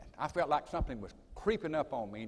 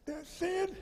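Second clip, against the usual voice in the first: a much higher, softer imitation of a friend's voice; imitating Sterling; 5 dB quieter than usual. Retelling the friend's phone calls, in a much higher, softer mimicking voice. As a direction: pitch way up and soft, mimicking the friend. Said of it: "And he talked like this".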